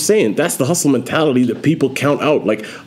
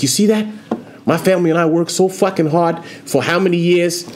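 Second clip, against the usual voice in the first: put-on Boston accent; 8 semitones higher than usual. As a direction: do a Peter Griffin-style Boston voice. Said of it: "Not a Boston accent, I'm trying".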